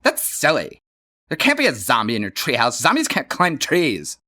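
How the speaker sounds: a touch high, fast and smug; know-it-all sister. Acted. Johnny's sister scoffs at the zombie, smug and quick. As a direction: dismiss him like a know-it-all.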